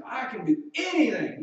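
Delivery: loud high bragging claim; mock boast. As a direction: puff up like a know-it-all. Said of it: "and they think that means".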